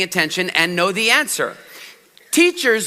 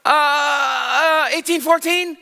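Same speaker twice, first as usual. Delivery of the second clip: high, drawn-out stalling, then a guess; hammed-up panicked wrong answer; startled pupil; 7 semitones higher, much slower than usual. Pupil put on the spot before the class, panicked guessing. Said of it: "And she's like".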